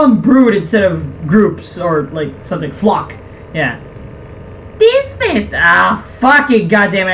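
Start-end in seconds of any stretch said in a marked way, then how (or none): 4.8-5.5 very high put-on lady voice. Villager lady begins on the festival, failed high lady voice. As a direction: attempt a lady, pitched way up, then give up. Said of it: "I can't do lady voice".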